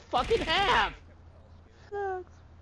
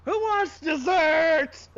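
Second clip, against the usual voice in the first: slow, loud called-out offer; 8 dB louder, slower than usual. Pretend. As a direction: belt it out like a cheery host. Calling out to the group.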